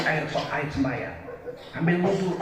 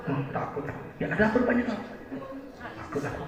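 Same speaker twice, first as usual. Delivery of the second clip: whispered, much softer; scared whisper; 14 dB quieter, faster than usual. Retold or imitated. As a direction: whisper your fear. Frightened drunk friend.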